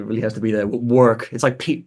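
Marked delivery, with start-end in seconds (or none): 0.6-1.3 "work" with a very hard American R; tough American R. Shown as a demonstration. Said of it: "on the R in work really has to".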